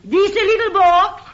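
hammed-up Italian mamma scolding her husband; Italian wife. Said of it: "I played Missus Guglielmo Marconi".

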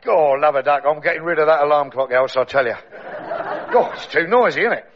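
lightly voiced Cockney pearly king, grumbling; a hint of a Cockney king moaning about his alarm clock. Pearly king grumbling to his wife Else, Cockney.

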